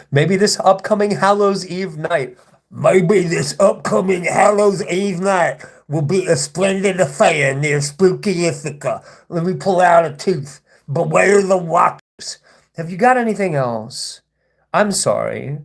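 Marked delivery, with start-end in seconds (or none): 2.7-9.0 slow, hammed-up villain voice; mock a troll's spooky supervillain act. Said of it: "like, supervillain voice". 9.3-10.6 hammed-up villain voice; mock supervillain. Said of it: "like, supervillain voice". 10.9-12.4 slow, higher, hammed-up villain warning; mock supervillain. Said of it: "like, supervillain voice".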